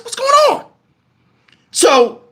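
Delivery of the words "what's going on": very high bewildered cry; acted panicked confusion. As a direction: cry out in alarmed disbelief.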